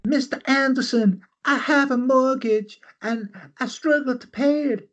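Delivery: flutter it like a Southern belle. Pretend. Widow coyly tells suitor about her mortgage, Southern belle. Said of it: "she's a Southern belle".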